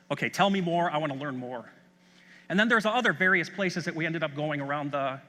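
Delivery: usual speaking voice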